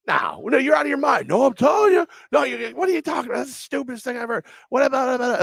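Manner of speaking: high, loud imitation of bickering coworkers; mock heated argument. Retelling two coworkers bickering, mock outraged yelling.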